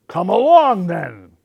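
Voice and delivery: loud, booming challenge; big goat daring the troll; big goat's cry. A narrator's reading. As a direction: call it out boldly. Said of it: "cried the big Billy Goat Gruff".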